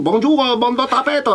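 high-pitched, hammed-up mock Chinese accent; accent asiatique. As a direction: caricature d'accent asiatique, très aiguë. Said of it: "il a un fort accent très prononcé asiatique". (accent asiatique très prononcé, voix haut perchée)

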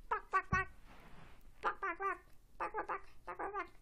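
high, quick barks in threes; pet animal barks. Pretend. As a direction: yap like a little toy pet. Pet character answers only in barks.

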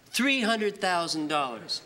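ordinary talk

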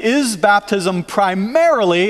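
slow, emphatic weight on "primarily"; stress on primarily. Real, not acted. Preacher rephrasing the baptism question, stress on "primarily". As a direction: draw out "primarily" to underline it. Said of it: "I'm emphasizing that word".

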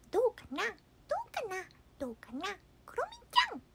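high, cute mascot voice; My Melody. Acted. Mascot asking Kuromi how it looks, high and cutesy. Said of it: "マイメロな んだけど".

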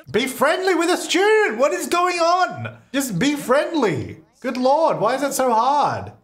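exasperated shout. Real, not acted. Player yelling at her disobedient sim, exasperated and high. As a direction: yell in disbelief at the stubborn character. Sim refuses social task.

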